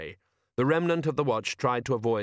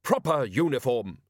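snapped; 3 semitones higher than usual. Acted. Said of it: "snapped the woman".

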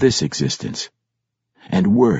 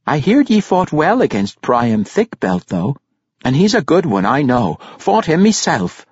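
higher-pitched, rambling dwarf mother; lively dwarf mother in a raised voice; 7 semitones higher, 3 dB louder than usual. A narrator's reading. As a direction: chatter proudly as a dwarf mother. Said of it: "Her voice trailed off".

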